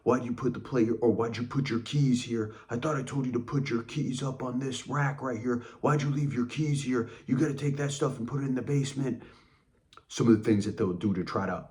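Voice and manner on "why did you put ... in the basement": higher-pitched imitation of a nagging rage; mock raging. Raging at you over a plate left out, mock nagging rage. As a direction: scold over small things, higher but not shouted. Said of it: "they will rage at you".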